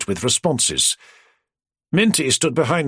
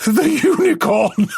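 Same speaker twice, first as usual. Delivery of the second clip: high, slower laughing shout; crying with laughter; spluttering laughter; 12 semitones higher, 4 dB louder, slower than usual. Acted. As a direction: burst out laughing on the word. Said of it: "He spluttered as he tried".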